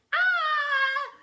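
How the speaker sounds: put-on high, loud children's scream. A narrator's reading. Class screaming at the smell, high loud scream. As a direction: shriek in horror. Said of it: "they would scream".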